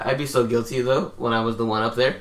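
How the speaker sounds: ordinary talk, nothing marked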